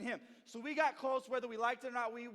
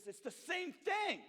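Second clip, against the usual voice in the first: 1 mock yell; 7 semitones higher, slower than usual. Brother arguing, yelling back.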